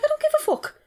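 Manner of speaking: very high-pitched retold defiance; defiant outburst. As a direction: re-enact indignant defiance, voice shooting up. Retold defiance to disapproving friend.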